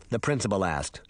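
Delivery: ordinary delivery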